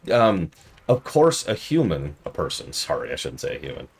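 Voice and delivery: ordinary talk, nothing marked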